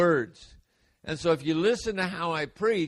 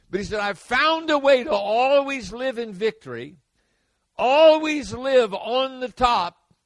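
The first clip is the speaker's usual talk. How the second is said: rising preacher's fervor, louder; proclaiming victory to the congregation; preaching; 3 semitones higher, 5 dB louder than usual. Acted. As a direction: preach it with growing force. Preaching Paul's claim.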